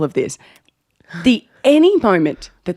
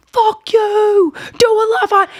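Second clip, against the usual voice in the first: high, drawn-out indignant holler; mock angry heckle; 14 semitones higher, slower than usual. Drunk Sheldon demanding a love heart, mock angry heckle. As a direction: imitate him hollering high at the stage. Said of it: "angry at Sam".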